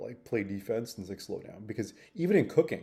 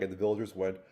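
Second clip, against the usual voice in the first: usual speaking voice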